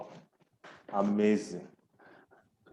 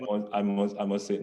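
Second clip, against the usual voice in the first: usual speaking voice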